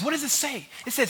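ordinary talk, nothing marked

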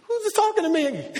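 high, puzzled princess voice; mock princess. Preacher imagines the princess, puzzled and pitched high. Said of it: "imagine the princess like".

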